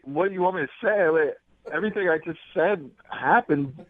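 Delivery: normal talking voice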